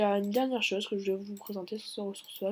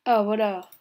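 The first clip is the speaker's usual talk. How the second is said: surprised find; 9 dB louder, slower than usual. Real, not acted. Finds the lost item.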